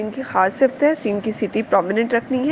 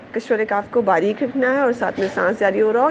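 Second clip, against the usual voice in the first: ordinary delivery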